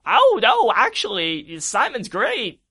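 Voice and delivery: hammed-up ghostly king; Poltergeist King. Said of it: "the Poltergeist King says".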